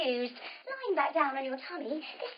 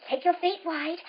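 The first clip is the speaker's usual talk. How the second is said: ordinary delivery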